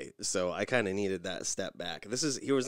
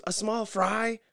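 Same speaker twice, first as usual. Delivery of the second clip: higher mock wife confirming; imitating Nikki; 13 semitones higher, 5 dB louder, slower than usual. Wife confirms her fry order, mock-high. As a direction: check it politely. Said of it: "Nikki goes".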